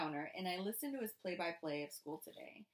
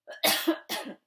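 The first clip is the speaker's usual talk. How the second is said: cough; 10 semitones higher, 13 dB louder than usual. Acted. Fake cough at the table.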